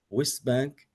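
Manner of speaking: normal talking voice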